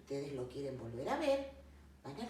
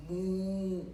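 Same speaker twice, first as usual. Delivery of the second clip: long, sustained moo; cow mooing; 5 dB louder than usual. Acted. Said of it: "La vaca". (mugido largo de vaca)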